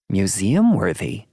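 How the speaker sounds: put-on high, loud incredulous old woman; perky old lady. A narrator's reading. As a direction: perk up in disbelief. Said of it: "Thelma perks".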